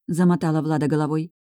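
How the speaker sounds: ordinary delivery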